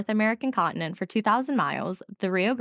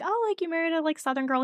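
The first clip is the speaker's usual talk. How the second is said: teasing in-laws; 10 semitones higher than usual. Retold or imitated. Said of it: "they were like".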